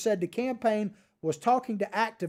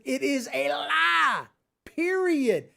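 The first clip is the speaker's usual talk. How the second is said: emphatic declaration; 6 semitones higher, slower than usual. Real, not acted. Denouncing campaign claim as lie.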